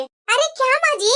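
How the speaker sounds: ordinary talk, nothing marked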